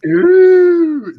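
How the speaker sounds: high, loud drawn-out ooh; a big mock-impressed ooh; excited ooh. Real, not acted. Reacting to the intuition question with a loud high ooh.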